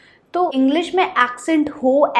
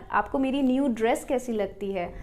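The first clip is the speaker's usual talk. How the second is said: ordinary delivery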